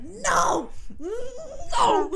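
high mock wail, breaking into laughter; retold scream. Betrayed man screams after the woman walking away, hammed-up wail. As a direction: imitate an anguished scream, drawn out.

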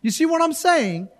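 ordinary talk

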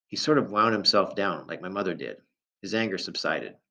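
plain reading voice

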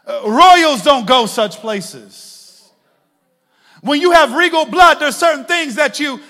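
preacher calling the church to holiness, loud; preaching at full force. Acted. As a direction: thunder it at the congregation.

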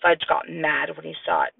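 ordinary delivery

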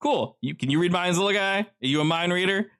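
Joe talking. Acted. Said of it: "Joe addresses figure".

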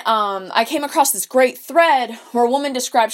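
ordinary delivery

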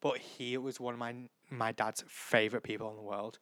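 ordinary talk, nothing marked

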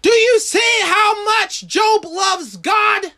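high-pitched, driving sermon delivery; impassioned preaching. Real, not acted. Preacher marvels at Job's love for God, impassioned preaching.

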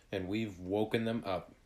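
usual speaking voice

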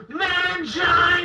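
high, slow, sung-out shout; mock wrestling cry. The teen wrestler calls out his finishing move, mock shout. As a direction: belt out the finishing-move name.

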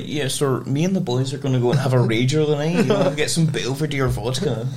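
mock Glasgow student accent; Glasgow uni accent. Host imitating Glasgow students planning a night out, mock Glasgow uni accent. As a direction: put on a party-going Glasgow uni accent. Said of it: "The Glasgow one's like".

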